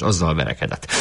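plain reading voice

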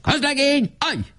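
very high barked order; rasping command. A narrator's reading. Weiss the lieutenant orders the private to halt, high command. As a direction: snap the order like an officer. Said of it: "Recsegő hangon kiáltott".

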